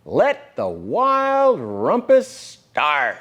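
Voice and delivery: mock grand call to play; gleeful cry. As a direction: announce the party like a little king. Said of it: "cried Max".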